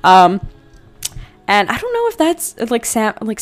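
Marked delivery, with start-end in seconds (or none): none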